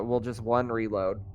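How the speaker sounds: ordinary delivery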